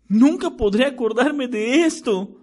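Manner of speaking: understated exasperated outburst; mild outburst. A narrator's reading. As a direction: throw down the quill in frustration. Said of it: "estalló Ron".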